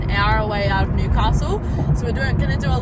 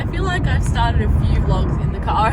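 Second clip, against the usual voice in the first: ordinary delivery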